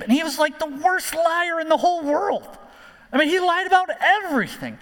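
high-pitched, incredulous hyperbole; emphatic exclamation. Real, not acted. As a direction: jump up high to hammer the exaggeration. Exclaims over Jacob's outrageous lying.

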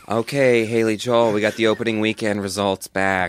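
loud, high boss announcement; loud announcing. Pretend. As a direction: call it out loudly like a boss. Boss announcing results loudly.

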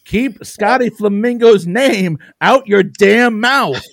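high, loud, slow mock fury; hammed-up scolding, dragged out; mock outrage. Mock defending wrestler from insult.

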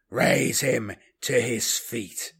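slow, measured command; commanding order. Acted. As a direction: give the order slowly and deliberately. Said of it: "he ordered".

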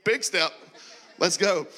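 eager hype. Retold or imitated. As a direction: fire yourself up for the leap. Acting his own eager inner voice.

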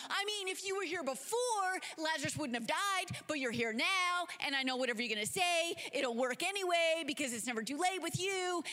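hyped, high-pitched gushing welcome; Martha. Acted. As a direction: bubble over with excited faith.

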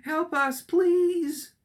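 high, gargling plea for help; gargled feminine cry. Acted. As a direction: cry out like a gurgling dying woman. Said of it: "The gargled feminine cries".

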